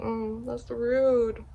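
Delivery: soft, slow mock agreement; dry murmured agreement. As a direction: humor her, quietly. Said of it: "I was like".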